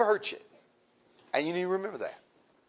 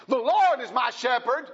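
quoting David, higher and louder; bold declaration; 7 semitones higher, 5 dB louder than usual. Retold or imitated. Preacher voices David saying it boldly. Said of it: "kind of boldly".

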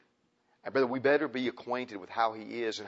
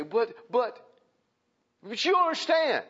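high-pitched, imitated pleading; hammed-up objector making excuses; mock pleading protest; 11 semitones higher, 4 dB louder than usual. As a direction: protest in a high voice, jumping up louder. Acted plea to the Lord.

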